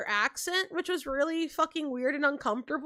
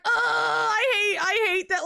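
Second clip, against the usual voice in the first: mild, higher-pitched groan of dismay; retold cringing dismay; 8 semitones higher, 4 dB louder, slower than usual. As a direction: groan as if mildly mortified. Acts out her cringing reaction.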